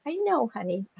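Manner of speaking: high, soft cooing; soothing aside. Real, not acted. Speaker pauses her story to soothe a pet, cooing. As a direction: coo softly to a pet.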